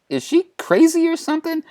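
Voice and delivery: high-pitched comic question; incredulous aside. Acted. Timid aside in comic retelling.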